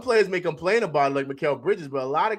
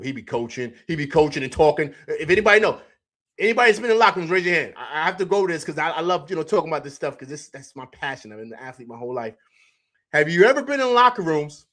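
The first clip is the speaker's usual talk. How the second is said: ordinary talk, nothing marked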